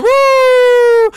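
loud, high whoop; excited cheer. Acted. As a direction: cheer for the couple. Celebrating the engagement.

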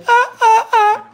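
high fake wail; mock wail. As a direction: whine high and long like a fake cry. Wail after mock lament.

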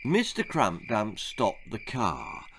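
ordinary delivery

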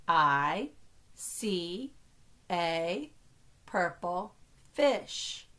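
slow, separated words for counting. Shown as a demonstration. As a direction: set each word apart with a pause. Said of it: "only count each word as one".

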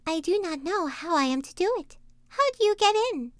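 put-on high little-girl voice; Gretel. A narrator's reading. Gretel plays ignorant to trick the witch, high child voice. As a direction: ask in a high, innocent child's voice. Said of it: "Gretel saw what she had in her mind, and said".

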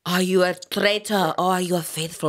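theatrical Scottish accent impression; Alan Cumming. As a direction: put on a Scottish TV host's accent. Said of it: "People were doing impressions of him".

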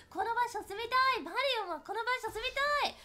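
high, eager exclamations; hammed-up childlike wanting; excited wishing. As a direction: gush it with rising pitch. Acts out wanting to live there.

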